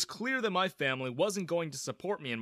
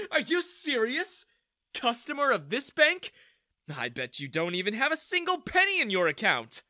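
laughing scornfully, higher pitch; mocking laughter; 9 semitones higher, 5 dB louder than usual. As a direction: ridicule him while laughing. Manager mocks the customer.

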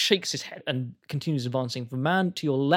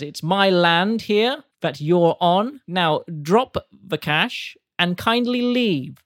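slow, loud, higher sneering command; menacing order; 5 semitones higher, 7 dB louder, slower than usual. Acted. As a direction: lean on each word with smug menace. Penny orders them out.

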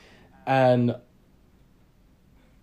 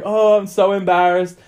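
higher, louder imitation of a mortified woman; mock embarrassment; 6 semitones higher, 6 dB louder than usual. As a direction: voice her cringing apology. Mortified girl's reply, retold.

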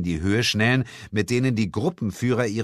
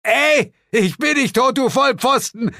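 high, loud yell from below; bellowing up; 7 dB louder than usual. A narrator's reading. Suko yells up from below that he isn't dead, loud. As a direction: shout up at them, offended. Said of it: "brüllte Suko zu uns herauf".

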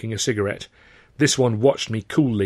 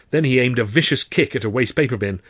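ordinary delivery

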